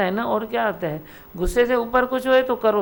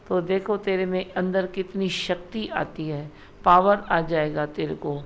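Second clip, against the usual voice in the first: usual speaking voice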